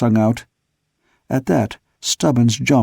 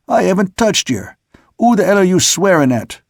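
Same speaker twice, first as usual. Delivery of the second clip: indignant retort; 6 semitones higher than usual. A narrator's reading. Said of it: "interrupted Plummer".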